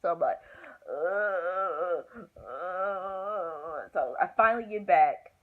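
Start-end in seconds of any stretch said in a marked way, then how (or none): 0.9-3.9 drawn-out, tearful mock moan; imitated labor groan. Trying to lay back for the check, imitated groan. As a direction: re-enact groaning through pain. Re-enacting contractions.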